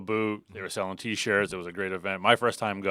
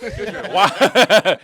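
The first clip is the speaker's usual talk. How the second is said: laughing; 9 semitones higher, 10 dB louder than usual. Real, not acted. Laughing at double entry.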